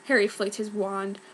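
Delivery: usual speaking voice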